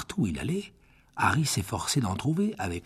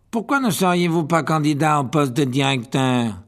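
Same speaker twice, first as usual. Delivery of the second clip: louder, fawning question; 4 semitones higher, 7 dB louder than usual. A narrator's reading. Malfoy flattering the teacher, loud. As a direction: flatter him for all to hear. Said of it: "d'une voix forte".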